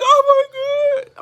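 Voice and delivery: high, loud, drawn-out mock gush; imitating emotional gasp. Acting out her own reaction to comments, slow high gush. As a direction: stretch it out, high and overcome. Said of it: "I'll be like".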